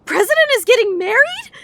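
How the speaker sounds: high shocked squeak. Acted. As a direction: blurt it out in disbelief. Shocked at marriage news.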